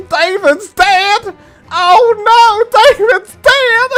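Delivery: very high, slow, loud mock hysterics; hysterical wailing. Tiffany wails the news, hammed-up hysterical. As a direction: wail it out like she's losing it. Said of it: "she starts crying, going absolutely ballistic".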